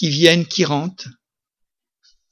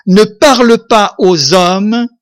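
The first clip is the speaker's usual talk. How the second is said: slow, louder proclamation of Paul's words; solemn scripture quote; 3 semitones higher, 6 dB louder than usual. Retold or imitated. Preacher reads Paul's verse to his church, slow and solemn. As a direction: declaim the verse slowly and gravely. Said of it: "il dira ceci".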